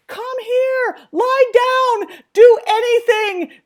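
high, loud, panicked yelling at a runaway dog; screaming. Retold or imitated. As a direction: scream frantic commands across a field, drawn out. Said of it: "me screaming".

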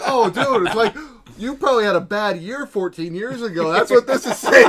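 joking quote through laughter; laughing. Retold or imitated.

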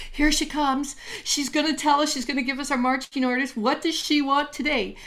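like spirits bustling for orders, excited and quick; eager spirits chattering. Acted.

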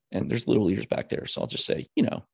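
normal talking voice

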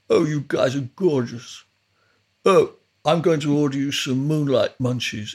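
lightly voiced, gushing studio owner; a hint of the boozy landlord doting on the band; imitating Chris Suma. Rehearsal room owner fussing over the band, lightly mimicked. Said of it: "he used to talk like this".

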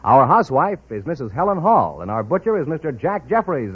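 usual speaking voice

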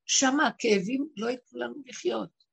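ordinary talk, nothing marked

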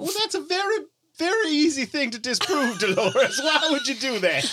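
high, laughing exasperation; laughing disbelief. Acted.

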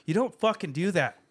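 loud, high, mock-scolding rant; ranting at himself. Telling his younger self off, loud and high. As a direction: yell at your past self to go to Australia. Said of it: "I'm like".

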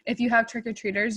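usual speaking voice

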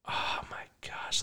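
breathless whispered oh my gosh; a sudden hushed mock gasp. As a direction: whisper it like a fan in shock. Mock emotional fan reaction.

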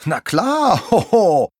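high, loud jolly pirate cheer; calling out. A narrator's reading. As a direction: crow it with a hearty ho-ho. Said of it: "ruft Captain Sharky".

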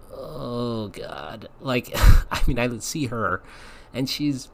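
ordinary talk, nothing marked

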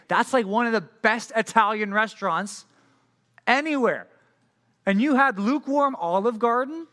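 hammed-up outrage at a terrible choice; exasperated disbelief. Coworker scolds over lukewarm Olive Garden.

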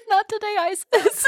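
high, hammed-up defiant quote; dramatic defiance. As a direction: fling it out like a movie heroine. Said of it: "That's basically what she says".

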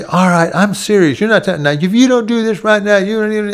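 put-on high, loud exasperated yelling at the kids; angry shout. Shown as a demonstration. Parent who has repeated it three times, yelling the order loud and high. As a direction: bark the threat like a fed-up parent. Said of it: "and then you shout at them".

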